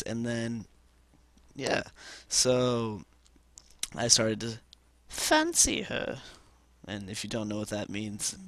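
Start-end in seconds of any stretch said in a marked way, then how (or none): 5.1-6.2 very high, drawn-out fake British; mock British. Telling viewers of his crush, put-on high British voice. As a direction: ham up a high British voice, slowly. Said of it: "it's British for".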